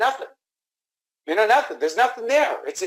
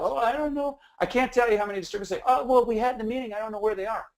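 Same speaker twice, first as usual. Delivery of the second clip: higher-pitched mock helplessness; clueless distributor; 6 semitones higher than usual. Distributors excusing a no-show, mimicked in a higher, helpless voice. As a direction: mimic a hapless excuse in a raised voice.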